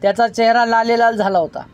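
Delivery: plain reading voice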